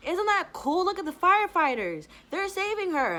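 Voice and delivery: a dad pointing out the firefighters to his son, gushing; excited dad voice. Retold or imitated. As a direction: play the thrilled dad showing his kid the firefighters. A passing father's remark to his son.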